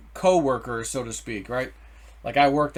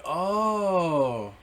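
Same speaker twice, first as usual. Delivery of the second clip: long, drawn-out knowing "oh"; slow arching "oh" of understanding; dawning realisation; 3 semitones higher than usual. Retold or imitated. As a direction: stretch it out as it all clicks. Acts out sudden realisation.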